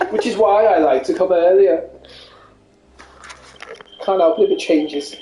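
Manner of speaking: a light posh runner voice. Acted. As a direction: play a smug posh jogger. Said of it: "it's my new voice".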